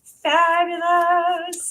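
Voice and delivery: "she is fabulous" slow, high and loud; gushing praise. Real, not acted. Cohost gushing about Jean to viewers, slow, high and loud. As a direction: savour every word of praise.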